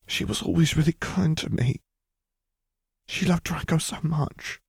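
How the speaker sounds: high, small grieving voice; wistful, high-pitched sorrow; mournful. A narrator's reading. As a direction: remember her tenderly, letting the voice soften. Pansy mourns a kind woman.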